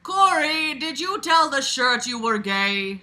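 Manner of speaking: high, loud dramatic quote; dramatic line reading. Acted. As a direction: read it like a soap opera line. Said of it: "she replied".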